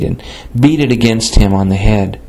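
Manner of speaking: usual speaking voice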